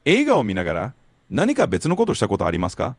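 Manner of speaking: fast fluent Japanese question; native Japanese question. Shown as a demonstration. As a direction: clear native Japanese prompt. Tutor asks question in Japanese.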